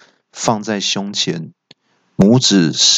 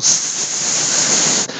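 long, sustained hiss; snake hiss. A narrator's reading. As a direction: hiss like a snake flicking its tongue. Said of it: "舌信的声音". (蛇吐信的嘶嘶声)

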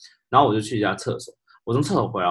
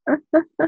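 laugh; 3 dB louder than usual. Real, not acted. Laughs at own story.

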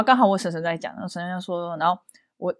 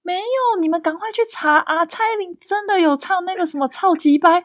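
loud insistence; 9 semitones higher than usual. Retold or imitated. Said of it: "阿嬷就很大声说".

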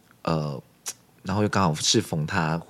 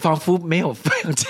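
laughing through the words, higher; 9 semitones higher, 4 dB louder than usual. Real, not acted. Joking about summer cram school, laughing. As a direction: giggle it out.

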